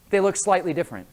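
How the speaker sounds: ordinary talk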